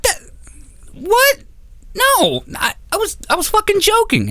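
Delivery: very high, shocked squeak; panicked backpedal. Retold or imitated. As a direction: scramble to take it back in alarm.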